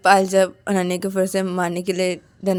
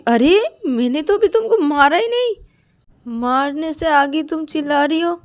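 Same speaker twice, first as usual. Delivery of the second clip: high, loud, astonished protest; indignant surprise; 8 semitones higher, 5 dB louder than usual. Acted. Payal scolds Ananya for screaming before being hit, indignant surprise. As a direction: exclaim in disbelief, pitched well up.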